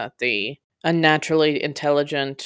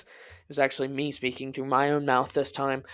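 ordinary delivery

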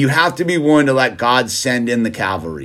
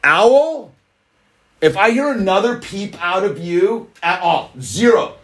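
firm, raised scolding voice; stern warning; 6 semitones higher than usual. Acted. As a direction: lay down the law to the kid. Scolding the kid during stream.

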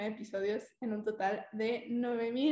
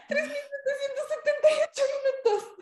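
shocked high. Real, not acted. Reading out her huge number.

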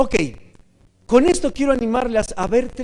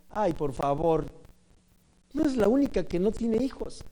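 indifferent dismissal; 7 dB quieter than usual. Acted. The others shrug off a woman's need, dismissive. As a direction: wave it away, unbothered. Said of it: "somos indiferentes".

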